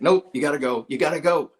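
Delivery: loud, blunt push to leave; telling them to get out now; retold loud kick-out; firm order out. Host throwing them out.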